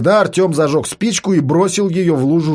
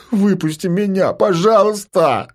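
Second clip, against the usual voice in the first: whining plea; 5 semitones higher than usual. Acted. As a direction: whine and beg to be let out. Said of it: "стал конючить Рома".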